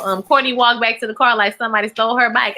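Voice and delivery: normal talking voice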